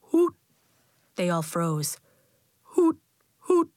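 short imitated owl call; owl hoot. A narrator's reading. Owl calls in the night.

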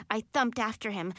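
ordinary delivery

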